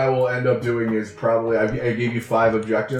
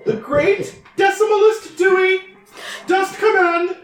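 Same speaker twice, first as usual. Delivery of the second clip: goddess voice. Acted. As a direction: boom it down from the heavens, high and grand. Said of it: "please use your goddess voice".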